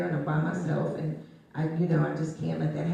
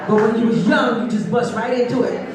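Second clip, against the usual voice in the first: loud emphatic; 5 semitones higher, 11 dB louder than usual. Real, not acted. Emphatic memory of youth.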